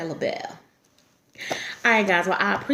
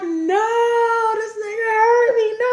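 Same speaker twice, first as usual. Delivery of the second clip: higher, louder, cry-laughing wail; mock embarrassed wail; 6 dB louder, much slower than usual. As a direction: whine in fake humiliation. I was so embarrassed.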